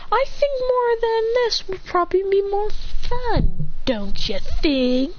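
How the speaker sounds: high, slow gloating question; bully toying with a beaten boy; sadistic taunt. Acted. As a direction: savor the cruelty, drawn out.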